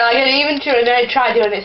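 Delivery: ordinary talk, nothing marked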